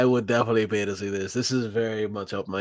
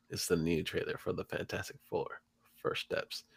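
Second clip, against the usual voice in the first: hushed trailer introduction; soft, secretive announcement; whispering; 5 semitones lower, 15 dB quieter than usual. Real, not acted. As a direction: drop to a near-whisper. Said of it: "Why am I whispering?".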